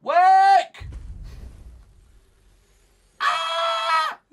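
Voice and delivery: very high, very loud screams; full-voiced furious shrieking; screaming in frustration. Real, not acted. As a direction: scream commands at the broken computer. Screaming at the computer to work.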